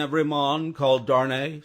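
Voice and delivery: loud stern official call; loud summons. Acted. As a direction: bark the name at the door. Soldier calls out name.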